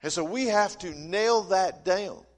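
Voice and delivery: ordinary delivery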